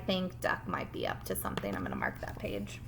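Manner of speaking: low hushed whisper. Shown as a demonstration. Teacher whispering that she will mark the page. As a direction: murmur it softly to yourself.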